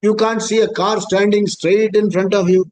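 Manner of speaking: ordinary delivery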